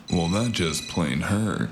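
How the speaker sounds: normal talking voice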